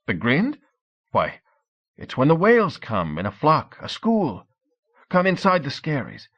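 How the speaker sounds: he can't believe they don't know; young Norseman explaining the whale hunt with relish; incredulous then excited. A narrator's reading. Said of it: "unable to believe his ears".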